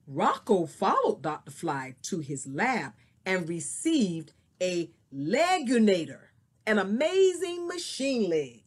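expressive storybook reading; story narration. Acted. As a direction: read it like a picture book. Teacher reads story aloud.

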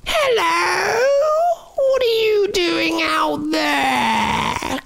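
high, slow croaky witch voice; croaking witch. Acted. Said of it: "croaked Witchy Poo Huxley".

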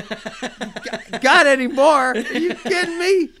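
high, loud, laughing disbelief; excited disbelief. Retold or imitated. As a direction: squeal it out, can't believe your luck. Thrilled retold reply to [name].

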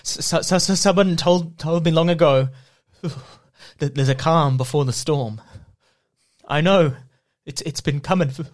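stammering, shivering delivery; very cold. Acted. Reading lyrics for the guessing game, acting very cold. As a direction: stutter through it as if freezing. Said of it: "but I'm very cold".

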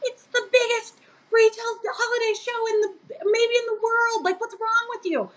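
imitated incredulous coworker, pitched very high; hammed-up disbelief at her ignorance; mock exasperated rant. Coworker Ray explaining the market to her, high exasperated mock rant. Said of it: "He's like".

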